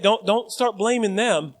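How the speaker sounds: usual speaking voice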